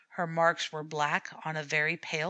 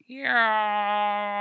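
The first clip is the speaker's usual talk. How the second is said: loud, held yowl; deep cat yowl; 3 semitones higher, 5 dB louder than usual. A narrator's reading. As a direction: yowl it low and long. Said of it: "in deep tone".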